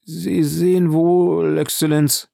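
high, drawn-out anguished cry; slow, pleading exclamation. A narrator's reading. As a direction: wail it slowly to a master. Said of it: "rief der Intendant".

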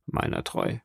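a quick whispered aside; whispered oath. Acted. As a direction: whisper Meiner treu under the breath.

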